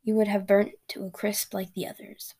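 ordinary delivery

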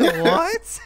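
high, loud rising "what"; astonished. Real, not acted. Reacting to an [name] song title, astonished. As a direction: burst of disbelief. Startled by search result.

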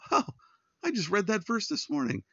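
light up as if it just clicked; surprised realization. Retold or imitated. Churchgoer recognizes pastor's verse.

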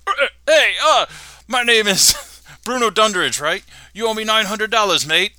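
Australian caller demanding his money from Bart; blustering Australian caller. Acted. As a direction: bluster through the demand like a cranky Aussie. Bruno talks to Bart.

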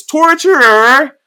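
long, high, loud drawn-out call; hammed-up royal bellow; shouted summons. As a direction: bellow for the torturer across the hall. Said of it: "And he goes".

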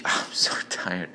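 normal talking voice

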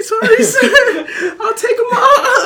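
very high, slow, squeaky whine; hammed-up scared squeal through laughter; mock meek apology. Worker giving in about the headphones, mock meek apology.

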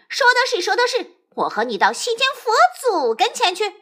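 high, eager monkey cry; both clamour to be judged; eager shouting. A narrator's reading. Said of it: "两个一起嚷道". (高声急切地嚷)

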